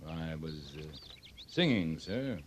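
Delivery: embarrassed low reply, barely audible. Acted. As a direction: own up to it meekly. Caught singing by the major.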